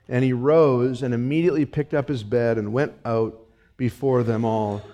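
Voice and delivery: ordinary delivery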